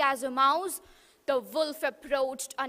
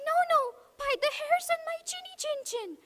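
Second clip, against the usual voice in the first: much higher, fast little pig voice; frightened little pig; 12 semitones higher, faster than usual. Acted. Little pig refusing the wolf, high and fast. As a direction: squeal out the refusal, quick and high.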